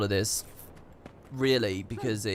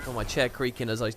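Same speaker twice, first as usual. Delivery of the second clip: ordinary talk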